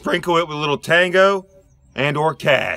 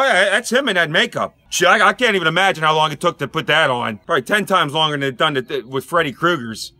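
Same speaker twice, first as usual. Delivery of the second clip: ordinary talk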